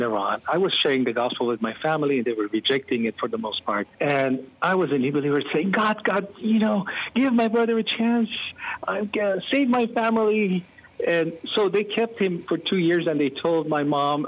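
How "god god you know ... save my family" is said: higher, earnest plea to God; retold desperate prayer, pitched up; pleading prayer. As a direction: beg God for your brother's chance.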